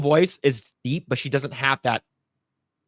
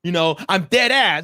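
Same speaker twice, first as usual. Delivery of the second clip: mock tough threat; 4 semitones higher, 4 dB louder than usual. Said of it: "acts very masculine as well".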